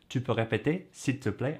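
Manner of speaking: Tu peux répéter, s'il te plaît with the pitch climbing on répéter and plaît; rising question tune. Shown as a demonstration. Teacher demonstrating the question tune to learners. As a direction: ask it by letting the voice rise. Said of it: "you go up at the end and it makes it a question".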